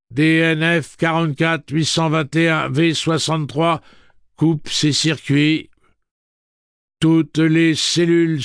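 high, loud, slow machine monotone; monotone robot voice. Acted. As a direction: read it like an amplified recording. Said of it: "d'une voix monocorde et amplifiée".

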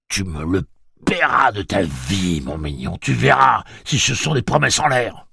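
high, loud, venomous threat; demented hatred. A narrator's reading. Gâcheur vowing revenge on Jake, loud and hateful. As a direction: threaten revenge with raging hate. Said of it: "un regard de pure haine démente".

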